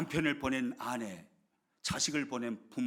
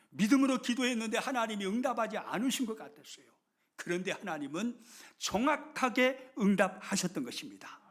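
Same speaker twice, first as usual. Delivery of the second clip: loud, high, fervent preaching; preaching at full force; 8 semitones higher, 7 dB louder than usual. Acted. Pastor proclaims God's different way to the congregation, at full force. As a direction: drive the point home from the pulpit.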